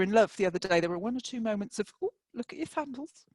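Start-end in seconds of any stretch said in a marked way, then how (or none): 2.0-3.2 grandma voice. Retold or imitated. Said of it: "lovely Grandma Kay, says".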